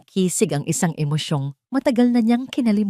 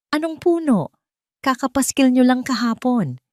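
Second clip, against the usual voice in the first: indignant raised voice, pitched high; shouting; 7 semitones higher than usual. Acted. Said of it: "sigaw ni Kisig".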